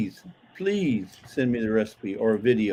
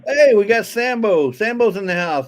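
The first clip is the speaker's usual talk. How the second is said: high, loud hype announcement; excited shoutout; 7 semitones higher, 5 dB louder than usual. Real, not acted. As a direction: hype up the donor. Announcing a super chat.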